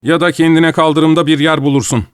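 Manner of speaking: loud, high-pitched warning; loud demand. A narrator's reading. Joe warning his sister she could end up homeless, loudly. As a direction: raise your voice in a threat. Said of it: "dedi Joe yüksek sesle".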